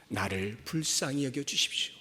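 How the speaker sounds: low, soft prayer of supplication; hushed plea. Acted. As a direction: plead quietly and low. Quiet take before the cried-out plea. (낮고 조용한 간구)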